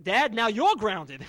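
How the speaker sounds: loud, slow punchline to dad; mock pun call. Kid announcing to dad, loud and slow. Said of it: "I said".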